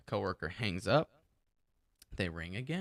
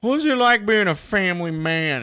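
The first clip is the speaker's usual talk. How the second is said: loud, high-pitched mock question; big, hammed-up interviewer voice; 9 semitones higher, 12 dB louder than usual. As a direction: belt out a pretend interview question.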